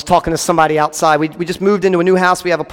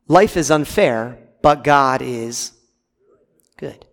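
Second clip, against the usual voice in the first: low and slow, pause before good; slow dramatic pause; 6 semitones lower, slower than usual. Real, not acted. Preacher comforting his church, slow with a held pause. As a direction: hold back, then land on good.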